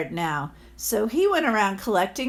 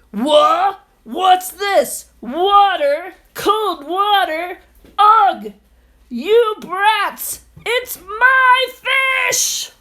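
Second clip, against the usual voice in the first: much higher, much louder outraged shouting; furious landlord; 10 semitones higher, 9 dB louder, much slower than usual. Acted. Landlord wakes and shouts at the boys, furious yelling. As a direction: explode at the thieving brats.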